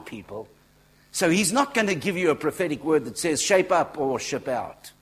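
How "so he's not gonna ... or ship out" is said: loud, high preaching outburst; emphatic shout. Real, not acted. Preacher correcting people about harsh prophetic words, emphatic shout. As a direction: belt it out, pitch falling toward the end.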